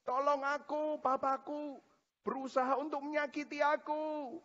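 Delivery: put-on high, softened child's wail; thin-volume, high-pitched pleading as a hurt child; crying child. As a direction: imitate a tearful toddler begging for help. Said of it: "Dia nangis".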